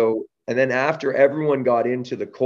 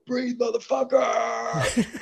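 drawn out, much higher, slow as a mock rapturous cry; ecstatic exclamation; much slower than usual. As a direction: stretch it out high and slow like a blissed-out shout. Hugging Wim, acting out exclamation.

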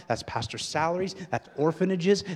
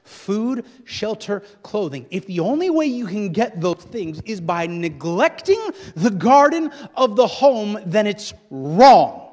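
raised voice swelling to a loud, drawn-out verdict; preaching, building; 8 semitones higher, 12 dB louder, slower than usual. Real, not acted. Preacher condemning neglect of the home, building to full force. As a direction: build hard, then land the last word long and loud.

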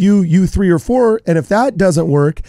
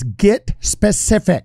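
lightly Canadian, slow and clipped; a hint of a Canadian accent; 2 semitones higher, slower than usual. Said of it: "my good, almost Canadian accent".

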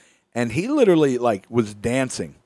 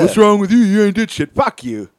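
mock angry boss; 6 semitones higher, 6 dB louder than usual. Quoting the roofing crew.

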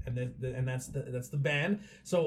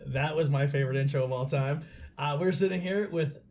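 ordinary delivery

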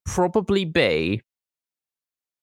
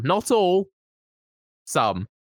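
pointed correction; 4 semitones higher, slower than usual. Real, not acted. Pointed aside.

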